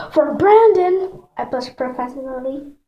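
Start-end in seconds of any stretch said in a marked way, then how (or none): 0.1-1.2 high, loud, drawn-out echo; startled question. A narrator's reading. Big sister reacts to mention of her crush, startled and high. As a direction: stretch the name out in high surprise.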